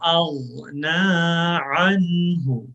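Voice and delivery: slow, drawn-out melodic tilawat; measured Quranic chanting; slow Quran recitation. Shown as a demonstration. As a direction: recite each word slowly for students to repeat. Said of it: "Please read after me".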